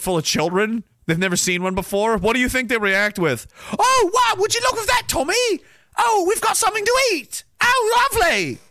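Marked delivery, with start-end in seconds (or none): 3.8-8.6 much higher, hammed-up gushing kid voice; mock delighted child. Children on a boat see a lobster, hammed-up high delight. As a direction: gush like a child thrilled at dinner.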